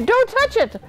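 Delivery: very high-pitched warning; shouting. A narrator's reading. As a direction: urgently warn them off. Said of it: "shouted Bartholomew".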